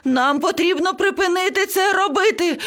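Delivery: high-pitched frantic squawking; panicked yelling. A narrator's reading. Parrot begging everyone to stop frowning, yelling in panic. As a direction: keep it urgent and high throughout. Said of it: "зарепетував папуга".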